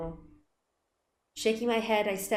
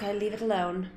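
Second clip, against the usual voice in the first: ordinary talk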